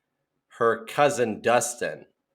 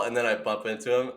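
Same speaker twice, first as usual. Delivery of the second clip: usual speaking voice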